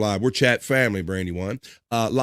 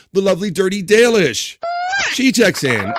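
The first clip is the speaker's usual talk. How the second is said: higher, louder laughing shout-out; hyped welcome; 8 semitones higher, 5 dB louder than usual. Real, not acted. Host hyping a returning chatter with a laughing shout. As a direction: announce her like a star arriving.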